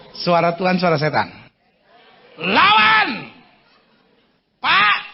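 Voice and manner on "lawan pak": commanding shout. Acted. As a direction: order the crowd to fight back. Preacher commands resistance. (teriakan tegas)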